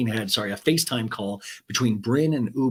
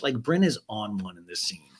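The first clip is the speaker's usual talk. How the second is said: ordinary talk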